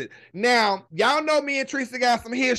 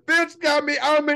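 normal talking voice

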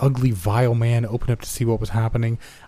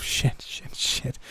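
hushed, breathy swearing under the breath; whispered cursing; 4 semitones higher, 6 dB quieter, much slower than usual. Acted. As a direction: curse to yourself in a panicked whisper. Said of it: "cursing to myself".

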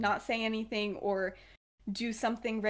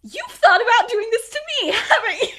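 high, loud, hammed-up accusation; mock accusing; 13 semitones higher, 13 dB louder than usual. Regulus accusing Sirius of betrayal, mock accusing. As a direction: fling the accusation in mock indignation.

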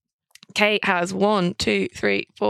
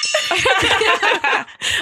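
high laugh; 9 semitones higher than usual. Real, not acted. Host laughing at players.

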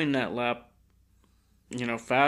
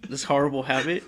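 talking through laughter, a bit louder; laughing; 2 semitones higher, 4 dB louder than usual. Real, not acted. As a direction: laugh it out while confessing. Laughing while confessing habit.

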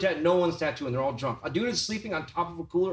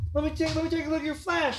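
much higher, quick and eager; mock-eager self; 11 semitones higher, faster than usual. Teller imitating himself asking to see the flash, high and fast. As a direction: rush it up high trying to sound casual. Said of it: "and I was all".

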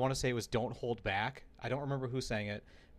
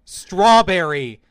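slow, very loud, higher imitation of uncle; retold louder yell; 6 semitones higher, 18 dB louder, much slower than usual. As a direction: yell it drawn-out at an unresponsive microphone. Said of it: "he's just increasingly yelling in volume".

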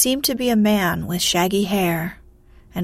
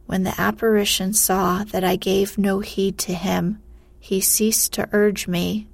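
ordinary delivery

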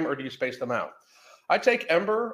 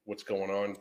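ordinary talk

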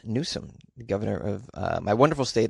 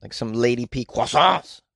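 normal talking voice

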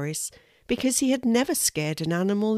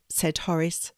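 ordinary delivery

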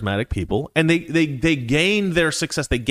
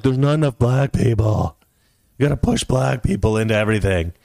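lightly put-on Hollywood executive voice; mock Hollywood. Host parodying Hollywood's push for diversity, mock executive voice. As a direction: mimic a studio boss making demands. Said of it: "now Hollywood's like".

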